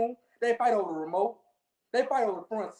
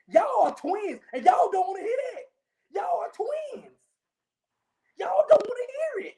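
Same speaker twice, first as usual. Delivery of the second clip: building call-out; 7 semitones higher, 3 dB louder than usual. Real, not acted. Calling out rival fans.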